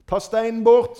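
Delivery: firm loud command. Retold or imitated. Jesus insisting to the protesting sisters, loud and firm. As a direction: repeat the order with force. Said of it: "sier Jesus".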